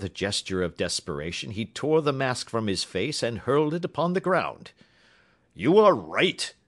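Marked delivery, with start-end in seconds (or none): 5.5-6.5 a loud, slow, desperate cry; the unmasked king crying out in agitation; cried out. A narrator's reading. King tearing off his mask, crying out to Holmes. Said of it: "he cried".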